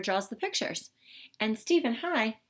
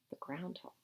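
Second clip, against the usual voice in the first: soft, hushed whisper; 5 semitones lower, 14 dB quieter than usual. Real, not acted. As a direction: let them in on a little secret. Aside pointing at picture.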